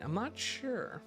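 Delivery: ordinary delivery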